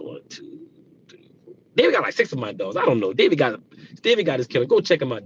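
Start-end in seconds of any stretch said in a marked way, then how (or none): 0.0-1.5 counting under breath; whispered counting. Real, not acted.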